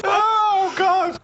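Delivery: high wail through laughter; real laughing exasperation; helpless laughter. Real, not acted. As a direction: cry it out while cracking up.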